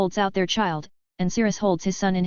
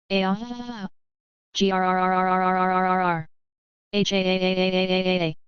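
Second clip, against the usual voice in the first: straining pushing groan. Acted. Final push in labor.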